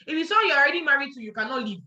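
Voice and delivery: ordinary talk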